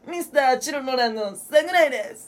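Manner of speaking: high, affected singer impression; exaggerated rock-singer voice. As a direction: introduce yourself in a high put-on star voice. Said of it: "あ、[name]さん".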